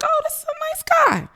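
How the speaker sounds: very high, gushing put-on voice; charmed stranger. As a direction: imitate a naive admirer in falsetto.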